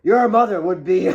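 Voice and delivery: loud furious father; angry shout. Acted. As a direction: bellow at the quarreling girls.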